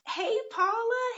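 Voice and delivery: high, slowed hey to a viewer; bright greeting. Real, not acted. Greeting Paula in the comments, high and drawn out. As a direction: call out a hello high and stretched.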